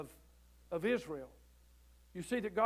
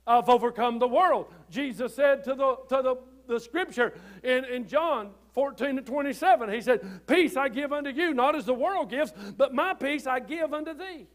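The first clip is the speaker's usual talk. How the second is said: loud, ringing preacher's proclamation of scripture; full-force preaching; 4 semitones higher, 9 dB louder than usual. Acted. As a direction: preach it out to the whole church.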